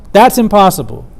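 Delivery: normal talking voice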